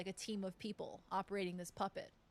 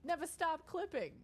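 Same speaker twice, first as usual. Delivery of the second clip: mock-urgent plea; 6 semitones higher than usual. Acting an eager clip request.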